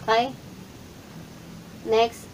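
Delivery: usual speaking voice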